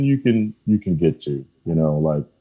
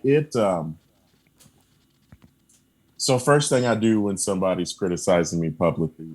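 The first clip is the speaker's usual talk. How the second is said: usual speaking voice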